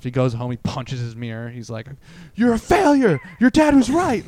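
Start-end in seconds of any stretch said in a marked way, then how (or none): 2.3-4.3 high, loud imitation of self-berating; mock self-loathing yell. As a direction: scream at yourself in the mirror, hammed-up. Acts man berating his mirror.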